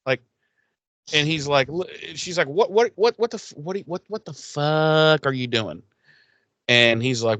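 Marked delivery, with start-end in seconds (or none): 2.5-5.8 higher, stammering what what what; startled flustered Buffy. Retold or imitated. Retelling Buffy startled by Spike lurking, flustered higher stammer. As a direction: sputter in shocked disbelief.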